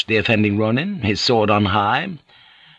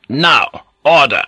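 raised, firm order; higher-pitched commanding call; powerful command; 5 semitones higher, 5 dB louder, slower than usual. A narrator's reading. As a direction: send them off with authority. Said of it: "the power of his voice".

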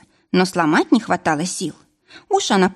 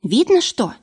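shout; 5 semitones higher than usual. A narrator's reading. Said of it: "прокричала Варя".

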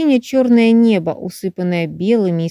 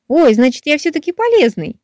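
overjoyed; 8 semitones higher, 3 dB louder than usual. A narrator's reading. Mushroom overjoyed at being useful.

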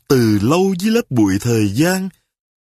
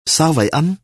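worried; 3 semitones higher than usual. A narrator's reading. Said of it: "lo lắng hỏi".

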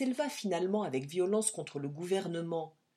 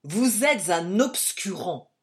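slow, loud, raging insult; loud, slow mock outrage; furious shout; 8 dB louder, slower than usual. A narrator's reading. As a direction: spit it out slowly in fury. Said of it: "d'un ton de fureur".

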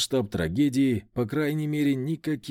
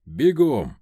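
резкий окрик; 6 dB louder than usual. A narrator's reading. Командует управляющему бежать.

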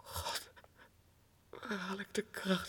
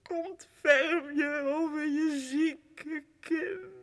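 slow, crying high plea; sobbing plea; 7 semitones higher, 5 dB louder, much slower than usual. Acted. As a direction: break down into tears. Final plea to mother. (langzaam en snikkend)

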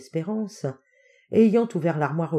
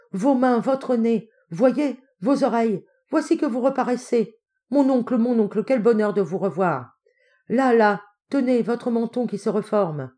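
raised, marvelling voice; excited wonder; 6 semitones higher, 4 dB louder than usual. Acted. Hopkins pretending his uncle is becoming visible, excited wonder. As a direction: point out each feature with delighted amazement.

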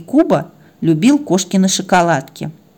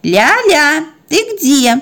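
higher, drawn-out call; 8 semitones higher, 3 dB louder, slower than usual. Acted. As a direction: call to a baby in another room. Owner calls the puppy. (громко зовёт малышку)

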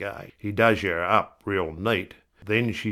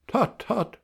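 a mild higher doctor tut; doctor tutting; 13 semitones higher than usual. A narrator's reading. Grinning doctor chiding the patient, higher.

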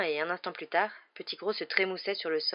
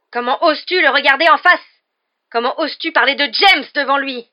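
high, very loud angry challenge; outraged roar; 8 semitones higher, 16 dB louder than usual. A narrator's reading. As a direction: roar it, scandalised. (rugissement indigné)

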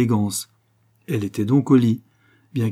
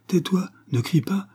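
high-pitched, urgent hushing; 9 semitones higher than usual. A narrator's reading. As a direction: hush the victim urgently, pitched well up. Attacker silencing his victim.

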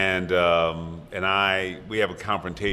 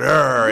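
a short, hammed-up monster growl, louder; mock Hulk roar; 6 semitones higher, 8 dB louder than usual. Hulk bursts out roaring.

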